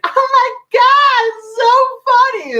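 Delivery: very high, drawn-out squeal of amusement; loud, slow, way-up-high mock shriek; loud gushing heckler. Comedian imitates an annoying loud woman at the show, high mock shriek. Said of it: "being, like, loud and just laughing".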